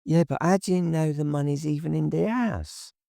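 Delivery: put-on nervous question from the timid girlfriend; Tracy, doubtful. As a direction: ask it quickly and uneasily. Tracy's voice in dialogue.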